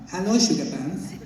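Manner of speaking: put-on comic character voice; Fez's accented voice. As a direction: slip into a sitcom character's accented voice. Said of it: "can I still do that voice".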